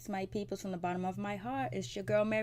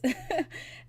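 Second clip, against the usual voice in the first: amused laugh; 9 semitones higher than usual. Real, not acted. Laughing at messy episode.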